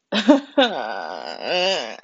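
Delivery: a laugh that slides into a near-cry; teary laugh. Real, not acted. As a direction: laugh out the relief, almost tearful. Laughing about finishing school.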